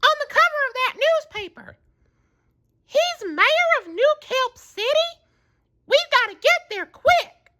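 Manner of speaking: very high urgent Texan squirrel; Sandy voice. A narrator's reading. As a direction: hurry them along.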